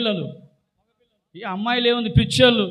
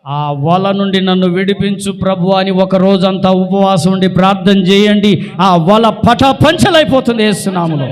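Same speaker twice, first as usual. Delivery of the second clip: louder, slower sermon proclamation; fervent pulpit delivery; preaching at full force; 6 dB louder than usual. Acted. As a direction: drive the promise home to the congregation.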